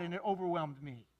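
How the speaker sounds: ordinary delivery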